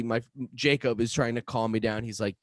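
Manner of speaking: ordinary delivery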